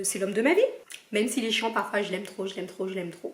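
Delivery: wife softly declaring love for her partner; tender. Real, not acted. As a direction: melt a little as you say it. Tender words about her partner.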